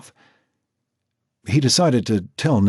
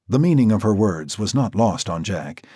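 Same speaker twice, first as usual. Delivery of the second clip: plain reading voice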